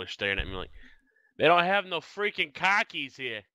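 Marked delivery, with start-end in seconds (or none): 1.4-3.4 slow, higher mock Southern drawl; imitated Southern accent. Telling friends about teasing his son, mock Southern accent. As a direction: lay on a hillbilly accent, slowly. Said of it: "what accent was that".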